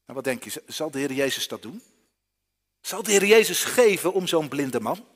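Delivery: ordinary delivery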